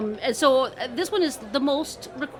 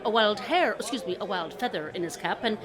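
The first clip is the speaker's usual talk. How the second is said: plain reading voice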